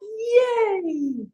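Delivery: high, sustained "Yay"; celebratory high whoop; group cheer. Shown as a demonstration. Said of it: "Once more".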